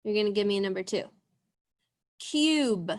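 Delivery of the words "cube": cube with a long U, falling; dictated word. Shown as a demonstration. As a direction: say it clearly for sorting. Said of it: "If you hear the long U sound".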